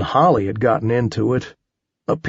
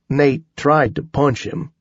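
plain reading voice

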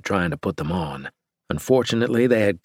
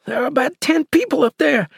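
very high, louder squeaky insistence; squeaky hiss; 5 dB louder than usual. A narrator's reading. Cannibal under pressure repeating the count to captor, squeaky. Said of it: "he hissed".

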